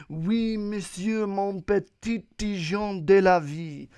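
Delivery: French accent. Shown as a demonstration. Podcaster showing listeners how to impress, hammed-up French accent. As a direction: put on a showy mock-French flourish. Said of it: "talk in a French accent".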